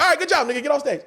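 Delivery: high-pitched quick dismissal; brisk stage send-off. Pretend. Host sends comic off stage, high brisk send-off. Imagined reaction after his set.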